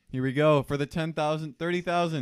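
hyped-up play-by-play call; excited commentator. Pretend. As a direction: build the hype for the jackpot. Mock commentary on scratch card.

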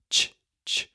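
ch repeated as a sharp breathy sound. Shown as a demonstration. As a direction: hiss out ch like a demo. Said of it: "So you're saying ch".

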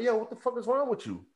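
high-pitched, exasperated challenge; retold indignation. Caller confronting the woman who keeps hanging up, exasperated.

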